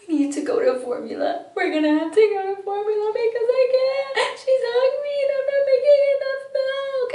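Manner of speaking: high, loud, tearful plea for formula; crying desperately through the words; retold crying plea. Mother retelling her breakdown to husband, tearful high cry. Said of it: "I'm like".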